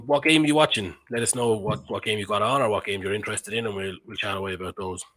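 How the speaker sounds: ordinary delivery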